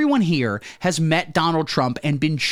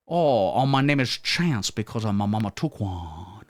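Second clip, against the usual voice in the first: put-on Cajun accent; 4 semitones lower than usual. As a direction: introduce yourself like a bayou Cajun. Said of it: "the New Orleans accent is more fun to do".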